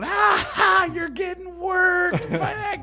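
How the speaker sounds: high, shrieking jeering cackle; mocking laugh. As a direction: cackle at your friend getting beaten. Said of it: "his buddy's over there laughing".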